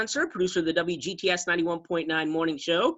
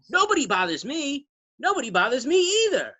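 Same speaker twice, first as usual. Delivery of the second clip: put-on kid's catchphrase; 7 semitones higher than usual. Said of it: "they both say".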